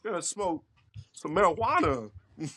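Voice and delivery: halting celebrity impression with odd pauses; Christopher Walken. Answering a greeting as a famous actor, halting impression. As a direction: break the phrases up oddly, mid-sentence. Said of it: "Oh, Mister Walken".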